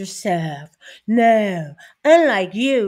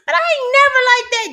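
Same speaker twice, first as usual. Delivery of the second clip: fast and loud, pitch jumps up; excited rant; 13 semitones higher, 8 dB louder, much faster than usual. Real, not acted. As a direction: rattle it off with rising heat. Rejecting Michelle's motto.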